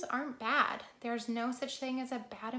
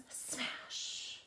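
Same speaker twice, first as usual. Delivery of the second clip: whispered sound-effect word; soft, voiceless echo of the word; hushed smash; 6 dB quieter than usual. Acted. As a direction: breathe it out softly, drawing out the sh.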